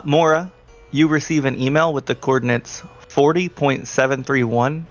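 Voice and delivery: normal talking voice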